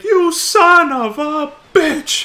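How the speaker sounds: imitated high, loud, drawn-out yell; furious outburst. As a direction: re-enact a character's furious shout. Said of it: "get really fucking serious like that".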